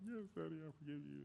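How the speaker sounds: put-on tiny, very soft little-kid voice; four-year-old child. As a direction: imitate a small child quietly forgiving a parent. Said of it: "he was like".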